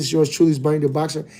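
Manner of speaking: ordinary talk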